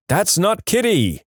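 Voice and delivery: high, slow call at reading volume; understated yell from a chasing parent; mild narrated yell. A narrator's reading. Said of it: "chasing my brother while yelling".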